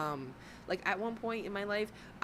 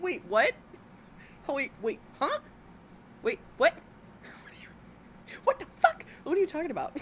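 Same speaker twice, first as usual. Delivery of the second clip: high, loud baffled disbelief; mock confusion; 9 semitones higher, 5 dB louder than usual. Imagining the crowd reacting, high loud baffled yells. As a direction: yelp it, bewildered and outraged. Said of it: "no one is like".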